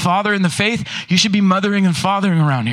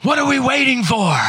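higher-pitched battle shout, imitated; mock rallying cry; 5 semitones higher than usual. Preacher quoting the dwarf before battle, rallying shout. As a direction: shout it like a film dwarf eager to charge.

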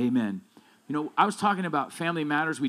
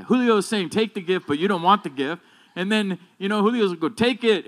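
louder, driving pulpit delivery; full-force preaching; 3 semitones higher, 4 dB louder than usual. Acted. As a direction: preach it hard to the congregation. Builds to a loud preaching climax.

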